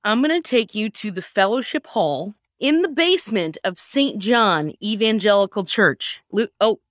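normal talking voice

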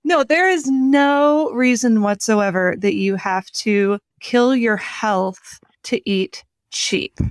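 usual speaking voice

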